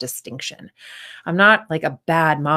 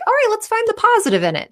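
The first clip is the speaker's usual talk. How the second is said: much higher, fast, chirpy fake positivity; mock forced cheer; 3 dB louder, faster than usual. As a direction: parody a relentless optimist.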